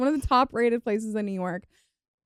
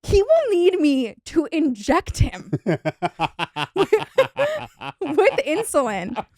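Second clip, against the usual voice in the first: laughing disbelief, slow and rising higher; 5 semitones higher, 3 dB louder, much slower than usual. Real, not acted. Telling her co-host about twice-daily insulin, laughing.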